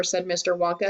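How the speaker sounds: plain reading voice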